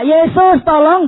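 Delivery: high, loud plea for help; retold shout for help; crying out. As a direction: call out high and loud to Jesus. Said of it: "dia teriak".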